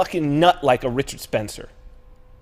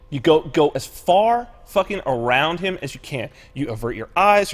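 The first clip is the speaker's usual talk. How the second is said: ordinary talk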